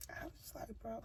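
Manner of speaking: breathy whisper of disbelief; hushed stunned whisper; whispered aside. Retold or imitated. Retelling speechless reaction, whispered.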